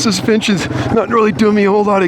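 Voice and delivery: panting between words while riding; heavy breathing, winded. Real, not acted. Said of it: "Sorry about the heavy breathing again".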